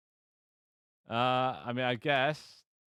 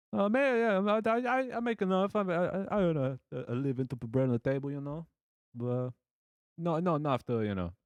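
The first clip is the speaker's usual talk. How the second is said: high-pitched, hammed-up Italian mafia type; Italian gangster accent; 5 semitones higher than usual. Italian gangster blacksmith on his living, mock mafia accent. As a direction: start high as a botched Italian mobster. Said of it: "Italian gangster, like a mafia".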